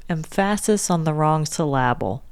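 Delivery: "syllable" said suh-LAB-ul; misplaced stress. Shown as a demonstration. [name] demonstrates the word for her co-host, "syllable" stressed on its second syllable. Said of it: "Please say the word".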